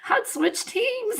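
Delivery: words squeezed out high through laughter; laughing through words. Real, not acted. Vlogger joking she'd switch teams, laughing high. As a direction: say it while cracking up. Cracking up at own joke.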